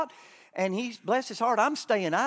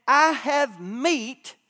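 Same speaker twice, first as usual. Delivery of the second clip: slow scripture quote; 5 semitones higher, 7 dB louder, much slower than usual. Acted. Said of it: "Jesus said".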